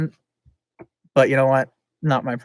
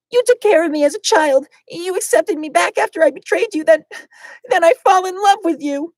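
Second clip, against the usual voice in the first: high loud grieving confession; high Rosé voice; 7 dB louder than usual. Acted. Rosé confessing love for Mikan, high and loud.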